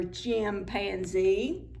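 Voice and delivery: ordinary delivery, nothing marked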